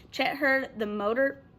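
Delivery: ordinary delivery, nothing marked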